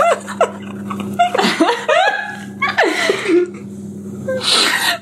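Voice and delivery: long high squealing laughing fit; helpless laughter. Real, not acted. Reader losing it at her own character line, helpless laughter. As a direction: laugh till it sounds like crying.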